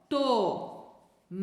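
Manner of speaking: Tomate drawn out; reading from the book; slow syllables. Shown as a demonstration. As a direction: split Tomate apart. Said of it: "o livro já separou em sílabas".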